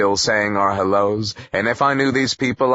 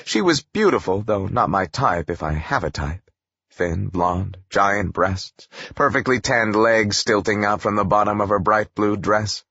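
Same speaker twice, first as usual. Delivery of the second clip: usual speaking voice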